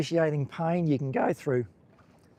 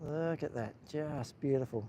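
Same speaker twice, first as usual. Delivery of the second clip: much softer, awed murmur; hushed awe; 10 dB quieter than usual. Real, not acted. Admiring the sunset colours for viewers, hushed awe. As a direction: let it fall away in quiet wonder.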